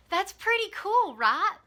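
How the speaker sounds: thrilled with her own discovery; excited. Real, not acted. Vlogger telling viewers about her route, excited. Proud of the walking plan.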